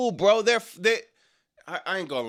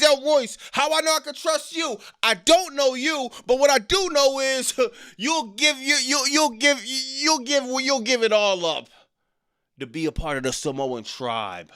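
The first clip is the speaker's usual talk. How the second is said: higher, pressing promo with a laugh in it; pretend fired-up wrestler; 5 semitones higher than usual. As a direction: pitch the better version of the line. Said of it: "L A Knight turned to Royce Keys".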